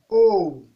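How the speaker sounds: Haut from mid pitch, easing down. Shown as a demonstration. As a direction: say Haut on a middle tone. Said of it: "Ton moyen". (« Haut » au ton moyen)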